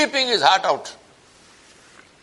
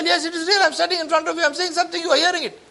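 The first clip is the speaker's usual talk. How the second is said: emphatic reassurance; 10 semitones higher, faster than usual. Pretend. Preacher voices a reassuring reply.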